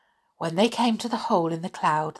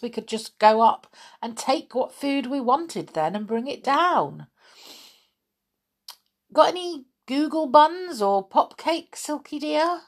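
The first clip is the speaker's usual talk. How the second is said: higher, wheedling request for treats; coaxing Moonface; 9 semitones higher than usual. Acted. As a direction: sweetly beg for buns.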